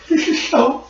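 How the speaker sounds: very high, squeaky put-on voice; tiny high-pitched comic voice; background character voice. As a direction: squeal it as a comic onlooker overcome with emotion. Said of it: "you hear in the background, you hear".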